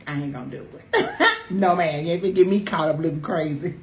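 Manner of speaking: ordinary talk, nothing marked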